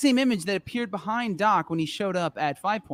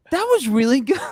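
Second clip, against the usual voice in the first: high, louder delighted praise; quoting impressed wife; 6 semitones higher, 5 dB louder than usual. Retold or imitated. Wife praising husband's accent, imitated as impressed. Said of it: "when she goes".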